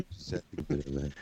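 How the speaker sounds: usual speaking voice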